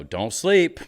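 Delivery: called out high and loud; yelled. Retold or imitated. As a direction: shout it after him across the street. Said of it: "So I yell to him".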